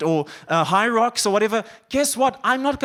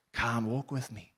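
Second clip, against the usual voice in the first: soft, slow tender invitation; 7 semitones lower, 11 dB quieter than usual. Retold or imitated. Preacher voicing God's call to the congregation, hushed and tender. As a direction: lower and softer, gently calling.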